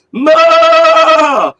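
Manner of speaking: high, loud, long goat "Ma"; goat bleat. Retold or imitated. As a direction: belt out a goat's bleat.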